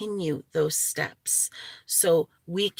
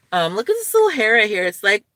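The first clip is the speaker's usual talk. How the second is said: ordinary talk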